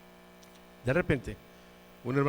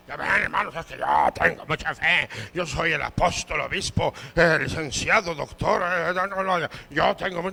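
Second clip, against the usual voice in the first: puffed-up, loud self-important preacher; hammed-up pride in a put-on voice; mock boasting; 6 dB louder than usual. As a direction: parade your titles, slowly and grandly. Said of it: "No se enorgulleció".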